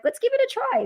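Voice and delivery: high, bright jump in pitch; eager resolve. Retold or imitated. Young instructor before a class of Korean kids, high eager resolve. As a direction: psych yourself up, game for it. Nervous teacher psyching herself up.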